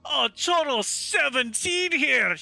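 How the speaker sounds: high, slow, drawn-out dwarf boast; triumphant dwarf. Acted. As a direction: play the dwarf announcing a sure win. Dwarf reveals dice triumphantly.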